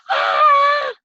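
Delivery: high shrieking scream of fright; terrified scream. A narrator's reading. Teddy seeing the bone fly at them, screaming in terror. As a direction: scream in panic. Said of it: "They all screamed".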